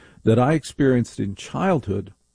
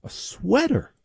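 high, scornful echo; squeaky mock outrage; incredulous echo; 11 semitones higher than usual. Real, not acted. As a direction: throw it back in disbelief.

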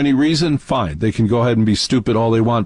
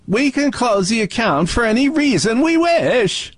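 high, robotic monotone Dalek; 12 semitones higher than usual. Retold or imitated. Host mocking the bank as a Dalek, high robotic monotone. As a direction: drone it like a Dalek. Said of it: "Like a Dalek".

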